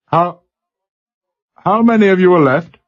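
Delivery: broad Glasgow lad on a crackly line; Glasgow accent. A narrator's reading. Glasgow boy answering the captain over the speaker, Glasgow accent. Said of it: "slurred Glasgow accent".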